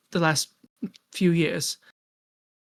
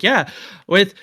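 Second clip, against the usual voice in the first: bright, higher vintage radio pitchman; old-timey advertisement voice; 7 semitones higher, 5 dB louder than usual. Acted. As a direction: sell it like an old newsreel ad. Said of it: "very, like, old-timey advertisement voice".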